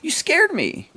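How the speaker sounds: put-on a small girl catching her breath after a fright; little girl startled by the adventurers, high-pitched; halfling child. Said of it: "And she says".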